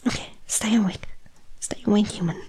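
fast, urgent whisper; whisper, keep him conscious. Acted. Urging a fading human.

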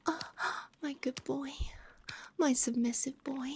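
ordinary talk, nothing marked

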